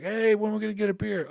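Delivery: high, jovial pal greeting a friend; buddy banter. Retold or imitated. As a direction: play the cheerful old roommate calling. Jovial old buddies on speakerphone.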